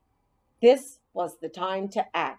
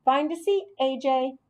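bus driver calling out; 7 semitones higher than usual. Acted. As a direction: call everyone aboard like a cheery bus driver. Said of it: "I heard Miss Victoria say".

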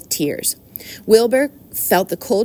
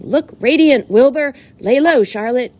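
raised, higher voice, firing off commands; urgent orders; 6 semitones higher, 6 dB louder, slower than usual. A narrator's reading. Old sheep readies animals as people come, urgent. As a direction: call out each warning urgently.

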